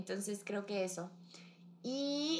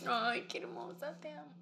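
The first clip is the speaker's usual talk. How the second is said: teary, choked-up words, softer; crying through tears; 5 semitones higher, 4 dB quieter than usual. Real, not acted. Friend sobbing her love back. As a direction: say it while really crying. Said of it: "Ya estoy chillando".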